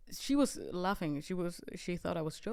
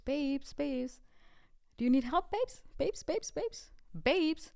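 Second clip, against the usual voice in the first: lightly voiced sing-song Londoner calling her babes, much higher; imitating Londoners; 5 semitones higher, slower than usual. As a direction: copy the friendly London "babes", pitched up. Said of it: "they called me babes".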